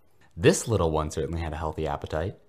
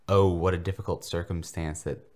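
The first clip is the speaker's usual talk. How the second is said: usual speaking voice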